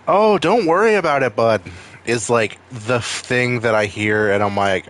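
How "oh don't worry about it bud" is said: loud, high mock Minnesota accent; Upper Midwest accent. Caller from Minnesota reassuring the agent, exaggerated Midwestern accent. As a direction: put on a singsong, high-pitched Minnesota accent. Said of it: "like, have that accent".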